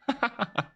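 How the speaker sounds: high-pitched real laugh; amused laugh. Real, not acted. Amused by chat's snail story.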